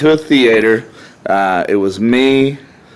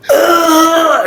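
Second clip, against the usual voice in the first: high strangled grunt after a fall; pained grunt; 3 dB louder than usual. Retold or imitated. Winded man groaning to friends, high pained grunt. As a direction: groan out like the wind's knocked out of you. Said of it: "and I said".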